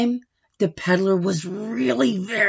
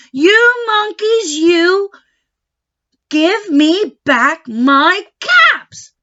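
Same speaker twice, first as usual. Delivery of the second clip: high, loud, slow angry demand; angry peddler; 8 semitones higher, 6 dB louder, much slower than usual. Acted. Angry peddler shouting up at the monkeys, slow and loud. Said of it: "This made the peddler angry".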